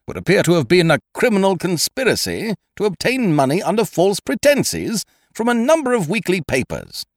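high, loud, indignant lecture; severe outrage. Acted. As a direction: scold them like an outraged gentleman. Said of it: "he said severely".

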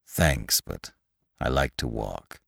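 low, hushed, halting refusal; weary walker. A narrator's reading. Lantry turning down the offered ride, weary. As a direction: turn it down softly, pitched very low.

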